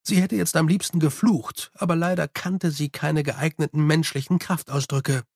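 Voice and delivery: usual speaking voice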